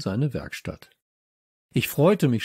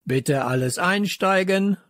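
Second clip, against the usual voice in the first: station announcer; 8 semitones higher than usual. A narrator's reading. Loudspeaker to passengers before departure, booming announcement. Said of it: "dröhnte es aus dem Lautsprecher".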